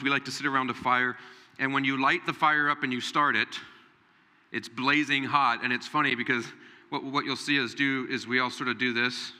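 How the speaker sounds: ordinary talk, nothing marked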